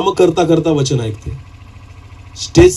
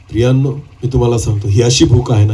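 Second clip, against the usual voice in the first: normal talking voice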